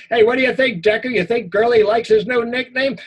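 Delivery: high, loud mocking taunt; mocking bully. Bully mocks the boy about his new nickname, loud. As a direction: jeer at him like a schoolyard bully.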